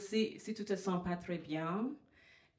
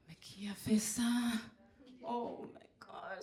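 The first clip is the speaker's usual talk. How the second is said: hushed, half-mock alarm; creeped-out whisper; 3 semitones higher, 3 dB quieter than usual. Comedian spooked by a note on her car, whispering. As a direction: whisper it as if someone might be listening.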